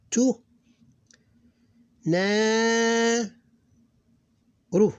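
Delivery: short "Two", long "Na", short "Ru"; separated syllables. Shown as a demonstration. Teacher separating syllables, "Two", long "Na", quick "Ru". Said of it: "Two different syllables".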